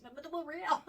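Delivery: giggling high insistence they were real; laughing protest. Retold or imitated. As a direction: insist through a burst of laughter. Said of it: "I went".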